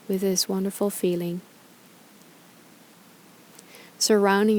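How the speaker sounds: calm, unhurried meditation guide with long pauses; guided meditation. Acted. Yoga teacher guiding followers through gratitude reflection, calm meditation voice. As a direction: ease listeners through each breath, leaving space between phrases.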